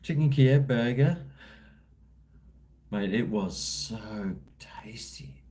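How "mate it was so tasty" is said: slow, breathy whisper of pure delight; savouring whisper. Real, not acted. To his stream, whispering slowly about a tasty burger. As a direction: whisper it like a food secret.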